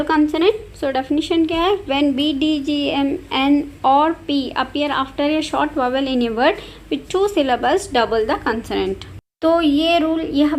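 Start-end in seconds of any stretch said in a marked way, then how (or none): 1.8-9.1 slow dictation. Shown as a demonstration. Reading the spelling rule aloud.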